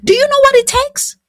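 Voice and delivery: outraged shout at lazy husbands; indignant shout. Real, not acted. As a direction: demand an answer, furious. Outraged rhetorical shout at men.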